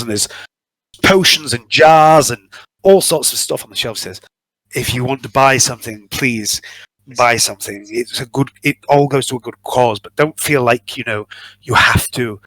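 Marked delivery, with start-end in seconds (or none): none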